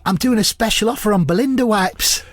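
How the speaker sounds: high comic impression. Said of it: "I'll just say them as [name]".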